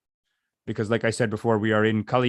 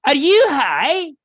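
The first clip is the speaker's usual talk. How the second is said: very high, slow, loud incredulous squeal; mock incredulous girl; 8 dB louder, much slower than usual. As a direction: shriek it out in slow disbelief. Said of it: "she's like".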